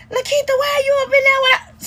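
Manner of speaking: high, mocking imitation of mama calling out. Daughter mimics mama pulling up, high mocking call. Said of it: "my mama pull up".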